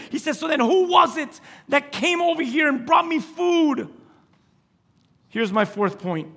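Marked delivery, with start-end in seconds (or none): 0.4-3.9 high-pitched retold demand; alarmed questioning. Preacher voices Isaac asking who came, alarmed. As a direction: act out a father's dawning alarm.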